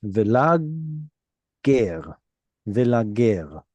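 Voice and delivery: velager split as velag, then ger; velager, carefully divided at the doubled g; in two syllables. Shown as a demonstration. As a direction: close the first part of velager on g, restart on ger. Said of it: "So I would pronounce it in two syllables".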